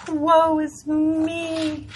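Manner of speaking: slow, hammed-up self-pity; mock woe. Host mocking her own weather complaint, hammed-up woe. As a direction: drag it out like a tragic heroine.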